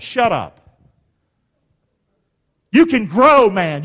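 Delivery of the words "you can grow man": ordinary delivery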